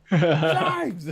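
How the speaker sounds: high, hammed-up yell of a name; mock yell. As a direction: yell after a friend who can't hear. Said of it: "I'm all yell out".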